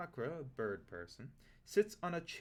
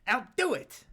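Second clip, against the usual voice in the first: much higher, louder goblin voice; goblin giving in; 10 semitones higher, 10 dB louder than usual. Acted. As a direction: squeak it out, suddenly won over. Goblin gives in to ogre.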